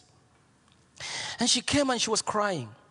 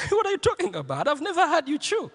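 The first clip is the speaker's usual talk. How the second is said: laughing; 6 semitones higher, faster than usual. Retold or imitated. Voicing the laughing husband.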